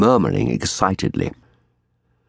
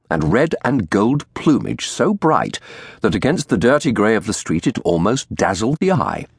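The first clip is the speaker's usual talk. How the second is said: ordinary delivery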